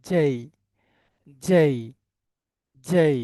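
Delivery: জেই with "j" and a gliding "ay"; corrected English J. Shown as a demonstration. Teacher shows how to say J, corrected pronunciation. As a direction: say জেই firmly as "jay". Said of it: "সবাই আমার সাথে সাথে বলেন".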